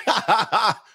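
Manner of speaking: high imitated ha-ha laughing; mock laughter. Host imitating viewers who laughed at his disses, mock laughter. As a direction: mimic an audience cracking up in high ha-has. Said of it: "you laugh".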